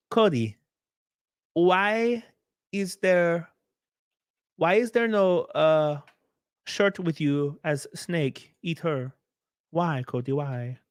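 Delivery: high-pitched, put-on plaintive question; high, drawn-out mock protest; mock pleading. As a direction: read it as an over-dramatic plea. Reads viewer's question as a plea.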